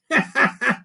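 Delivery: high wailing cackle; creaky wailing laugh. Acted. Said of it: "creaky way of laughing".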